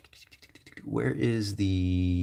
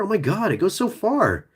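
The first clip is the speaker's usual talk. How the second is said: surprised; 12 semitones higher than usual. Real, not acted. Amazed at mask length.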